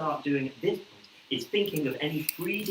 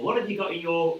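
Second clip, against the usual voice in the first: usual speaking voice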